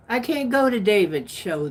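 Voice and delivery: normal talking voice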